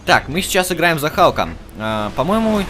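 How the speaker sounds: normal talking voice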